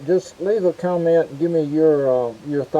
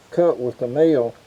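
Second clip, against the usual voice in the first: ordinary talk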